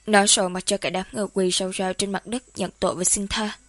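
ordinary delivery, nothing marked

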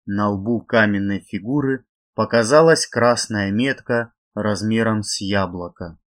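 usual speaking voice

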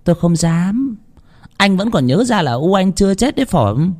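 high-pitched sulky sarcasm; huffy. A narrator's reading. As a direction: sulk aloud like a slighted mother. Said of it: "bà nói giọng giận dỗi".

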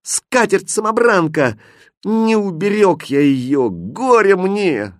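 high wail of despair, drawn out at the end; acted anguished outcry; desperate cry. A narrator's reading. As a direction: lament your loss aloud. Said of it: "отчаянно закричал".

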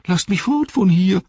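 high, loud whine; whining. Acted. Wormtongue whines to Treebeard in a high voice. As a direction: whimper the plea like a beaten cur. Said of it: "winselte er".

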